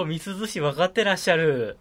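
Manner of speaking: eager anime nerd voice; otaku voice. Pretend. Playing otaku chat.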